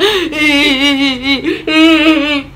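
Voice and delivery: long, high gasping whine; mock crying. Child staring at mom, high imitated sobbing.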